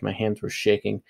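ordinary delivery

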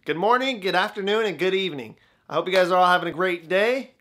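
loud, upbeat channel greeting; YouTuber intro. Acted. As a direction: open the video big and bright.

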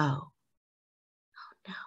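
ordinary delivery

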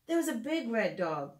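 lilting storybook read-aloud; gentle storytime narration for little listeners; reading to children. Acted. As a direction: read it like a bedtime picture book. Reads picture book to children.

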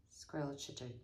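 ordinary delivery, nothing marked

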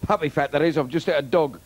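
loud punchy club comic patter; stand-up delivery. Acted. Old Cockney comic working the crowd, loud punchy patter. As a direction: belt the gags out to the room.

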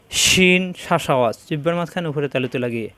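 ordinary delivery

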